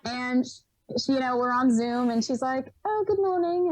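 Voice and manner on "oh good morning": light, higher imitation of a British accent. Interviewer greeting her on Zoom, in an imitated British accent. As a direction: chirp a British good morning, lightly imitated. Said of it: "she had this lovely British accent".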